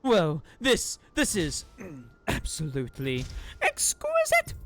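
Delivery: slow, strained fake compliment; forced praise. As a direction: drag it out, choking on the lie. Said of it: "Exquisitely bad, that is".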